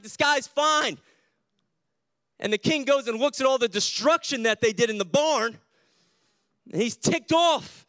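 high, loud storytelling preach; fired-up preaching. Acted. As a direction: build the barnyard chaos with full pulpit energy. Preaching the story at full force.

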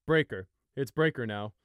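put-on loud, firm older brother; firm correction. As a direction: cut him off firmly. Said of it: "Fleece cut in".

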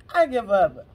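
loud, exclaimed between laughs; amused surrender. Real, not acted. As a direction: throw it out loudly, still amused. Laughing in amazement at card.

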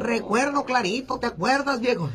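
high-pitched reminiscing granny; old lady voice, pitched well up; abuelita. Acted. Grandma recalling it clearly to her old man, high granny voice. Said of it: "le dice".

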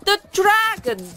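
the crowd shrieks in terror; shrieking crowd. Acted. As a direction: cry out as the fear peaks. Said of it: "a crowd of people shouting and crying".

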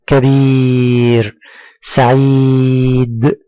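each word's ī (ي) held very long on a level note, as in كبير، سعيد; slow model words, stretch the ي in كبير، سعيد، قريب; long drawn-out vowel. Shown as a demonstration. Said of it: "هو مد الصوت للحرف الذي قبله بالكسر". (مدّ الياء طويلًا في «كبير، سعيد، قريب»)

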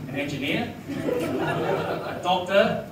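ordinary talk, nothing marked

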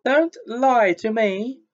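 very high, loud, slow wail; gasped, tearful. A narrator's reading. Myrtle in tears, wailing high and loud. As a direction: cry out, hurt and gasping. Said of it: "Myrtle gasped".

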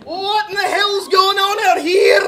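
loud, high Scottish shout; furious Scottish yell bursting out of a tent; Groundskeeper Willie. Retold or imitated. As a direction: bellow it like an angry Scottish groundskeeper. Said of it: "like groundskeeper Willie".

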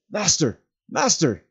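high, loud call down the cliff. A narrator's reading. Sam calling down the cliff for his master. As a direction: call into the dark for him. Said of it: "he called".

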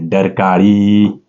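डरकाळी stretched out very slowly; drawn-out repeat. Shown as a demonstration. Teacher giving students the tiger's sound word, डरकाळी drawn out slowly. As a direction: draw out डरकाळी for emphasis.

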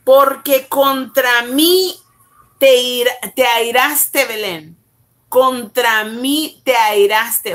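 forceful slow Bible reading; preaching. Acted. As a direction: proclaim each word slowly. Reads scripture with force.

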